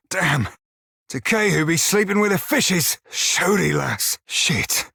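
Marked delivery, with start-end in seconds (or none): 4.3-4.9 whispered curse. Acted. Defeat in battle, breathed.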